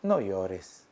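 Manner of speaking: soft consoling voice of the Lord; tender. Acted. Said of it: "El Señor te dice".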